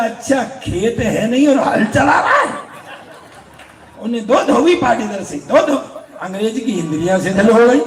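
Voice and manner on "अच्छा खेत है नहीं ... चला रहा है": mock him like a scornful village elder; hammed-up Haryanvi taunt; Chaudhary's taunt. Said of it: "Chaudhary बोला".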